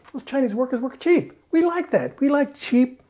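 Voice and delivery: high, smug voice of company bosses; mock gleeful executives. Board of directors gloating over cheap labor, high mock voice. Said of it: "They're going".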